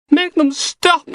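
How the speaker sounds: high, slow terrified wail; panicked shriek. Acted. Knox panicking as Logan grabs his sleeve, high terrified wail. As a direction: shrink back in terror and cry it out.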